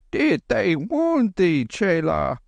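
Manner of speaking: high, slow calling; slow high worried old monk; anxious Lama. Acted. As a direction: call out gently in the dark.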